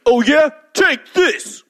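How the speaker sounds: high, loud attack yell; battle cry. Acted. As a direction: shout it as you strike.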